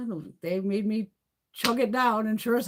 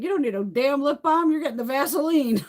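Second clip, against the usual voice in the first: higher, louder scolding grandma; 8 semitones higher, 6 dB louder than usual. Retold or imitated. Grandma scolding the grandkid about lip balm, high and loud. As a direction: bark it like a bossy grandma.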